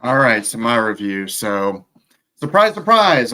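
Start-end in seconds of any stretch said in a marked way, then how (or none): 2.4-3.3 sarcastic. Acted. Announcing his predictable review.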